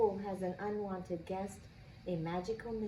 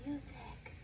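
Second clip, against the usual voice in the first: hushed, much softer single word; whispered; 3 semitones higher, 11 dB quieter than usual. A narrator's reading. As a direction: breathe the word out secretly. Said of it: "I whispered".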